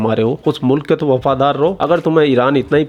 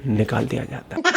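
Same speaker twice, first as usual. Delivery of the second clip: usual speaking voice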